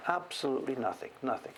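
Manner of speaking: ordinary talk, nothing marked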